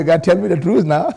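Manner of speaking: normal talking voice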